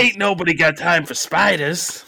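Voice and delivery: put-on high, loud botched-accent riff; hammed-up mixed American accent; mock New York accent. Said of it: "you sound like you moved from New York".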